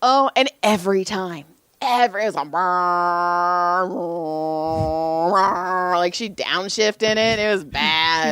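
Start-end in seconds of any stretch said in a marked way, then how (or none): 2.5-6.0 long, rumbling motor-like raspberry; imitated fart noise. Mimicking a client's farts, long rumbling noise. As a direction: draw out a revving brrr like downshifting.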